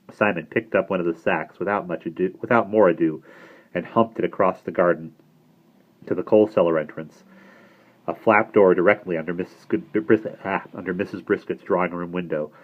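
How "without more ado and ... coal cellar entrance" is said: ordinary delivery, nothing marked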